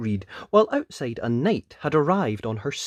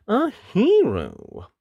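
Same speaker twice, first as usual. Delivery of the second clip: usual speaking voice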